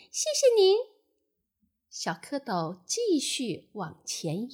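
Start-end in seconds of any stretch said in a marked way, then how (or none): 0.1-0.8 high, loud little tadpole thanks. A narrator's reading. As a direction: pipe up politely as a baby tadpole. (小蝌蚪高声道谢)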